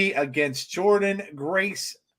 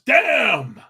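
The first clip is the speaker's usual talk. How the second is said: loud catchphrase exclamation, pitched up then falling; Ron Simmons; 5 semitones higher, 6 dB louder than usual. Acted. Co-host imitating a wrestler's catchphrase, loud and emphatic. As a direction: belt out one drawn-out, falling damn. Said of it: "In my Ron Simmons voice".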